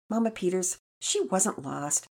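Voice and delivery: lightly voiced little boy, eager. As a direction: jump in to defend a sister. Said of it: "Pearson jumped in".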